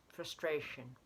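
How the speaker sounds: plain reading voice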